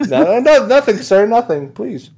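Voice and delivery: high, loud backpedalling; panicked pleading. Acted. Homeowner caught muttering, high mock pleading.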